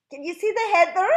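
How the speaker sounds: high, loud hammed-up Scottish accent; bad Scottish accent. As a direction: ham up a Scottish brogue badly. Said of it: "that was a bad accent".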